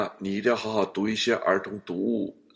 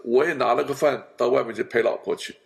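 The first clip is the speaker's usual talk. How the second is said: ordinary delivery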